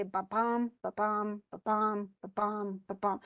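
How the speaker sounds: "ba-bum" five times, weak BA, strong BUM; iambic beat. Shown as a demonstration. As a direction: count out the pulse with "ba-bum", stressing each BUM. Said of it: "That's why I say".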